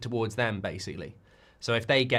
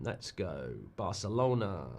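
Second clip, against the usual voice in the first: normal talking voice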